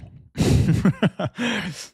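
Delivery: sudden high burst of laughter; loud laugh. Real, not acted. Laughing at chat's complaints.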